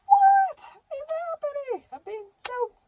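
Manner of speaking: very high, drawn-out strained cry; high-pitched mock panic while choking; panicked, being choked. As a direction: squeal it out like you're being strangled.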